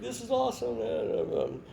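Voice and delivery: put-on a slow, high, delighted kid's voice. Freshman inside the mascot suit, slow and high with delight. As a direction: drawl it out like a thrilled teen. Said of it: "and he's like".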